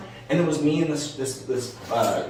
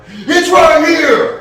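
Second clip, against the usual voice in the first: loud, high, drawn-out yell; re-enacted panicked shout at the driver; retold sudden yell; 13 dB louder, much slower than usual. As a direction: blurt it out at top volume, stretching the words. Retold sudden call while driving.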